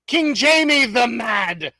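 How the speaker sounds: slow, grand British announcement of the name; playful British accent, stretched out; hammed British accent. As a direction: announce the king like a British herald. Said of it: "I have to read it in a British accent".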